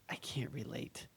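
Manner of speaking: a hushed, voiceless whisper of the line. Retold or imitated. Wife Natalie quietly telling a girlfriend, whispered. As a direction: whisper it like a private aside.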